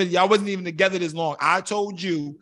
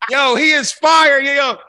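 loud, slowed, higher disbelieving yell; outraged yelling; 5 semitones higher, 8 dB louder, slower than usual. Real, not acted. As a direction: shout it like he can't believe the answer. Outraged at friend's answer.

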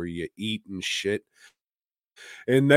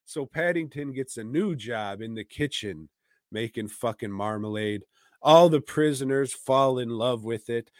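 normal talking voice

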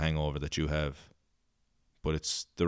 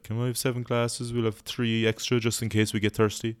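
a hint of a European accent, pitched up; vague European accent; 4 semitones higher than usual. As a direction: order like a tourist with a light European accent. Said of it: "I don't know where my accents are coming from".

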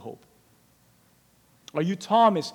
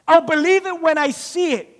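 higher, insistent refusal; stubborn refusal; 9 semitones higher, 4 dB louder than usual. Retold or imitated. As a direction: plant your feet and refuse. Thomas repeating his refusal.

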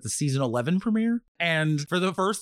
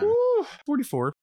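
excited ooh. Real, not acted. Excited episode number.

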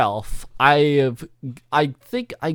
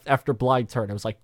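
normal talking voice

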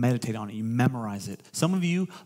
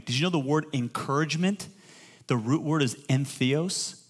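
normal talking voice

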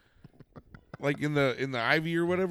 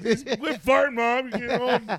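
raised, high-pitched yelling back; mock scolding shout; 8 semitones higher, 4 dB louder, slower than usual. Son shouts back blaming his mom, mock scolding yell. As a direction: playfully holler blame at your mother.

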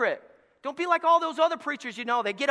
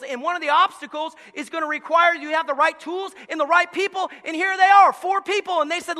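normal talking voice

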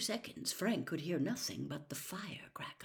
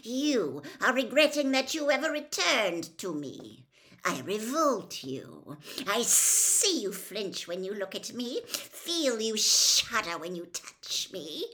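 loud, emphatic villain voice; cold angry accusation; 3 semitones higher, 10 dB louder than usual. Acted. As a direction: hammer the accusations, drawing out key words. Cold master accuses his servant.

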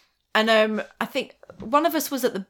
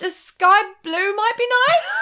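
higher, mock breezy secretary; playing along; 10 semitones higher than usual. To her date about office colours, higher mock breezy. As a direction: play the eager assistant. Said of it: "I played along".

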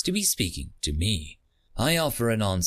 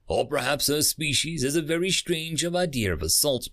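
plain reading voice

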